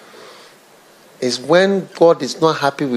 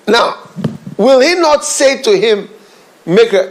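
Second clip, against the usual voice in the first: high, raised fervent preaching with a laugh; preaching at full force; 10 semitones higher, 5 dB louder than usual. Real, not acted. Preacher rousing the congregation, fervent and raised. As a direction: press the point hard from the pulpit.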